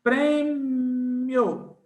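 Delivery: Prêmio with the vowels joined, one movement; teacher models Prêmio joined; said together. Shown as a demonstration. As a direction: say Prêmio in one smooth glide. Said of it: "você diz junto".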